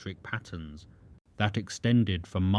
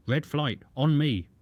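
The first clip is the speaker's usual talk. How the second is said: high, loud battle order; shouted command; 6 semitones higher, 3 dB louder than usual. Acted. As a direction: bark it over the radio to the squadron. Pilot calling his flight in combat.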